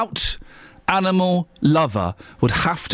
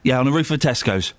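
normal talking voice

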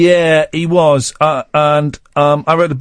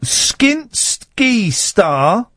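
slow, stretched, higher headline voice; headline read; 6 semitones higher, much slower than usual. Acted. Host reading a tabloid headline, slow and high. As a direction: announce the pun headline grandly.

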